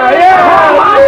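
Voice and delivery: loud high cheer. Acted. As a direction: shout it as a cheer. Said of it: "Let's really hear it!".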